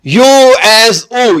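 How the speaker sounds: یو ایس او said slowly, letter by letter; teacher stating the spelling rule; slow spelled-out rule. Shown as a demonstration. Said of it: "جب او کی آواز دیتا ہے".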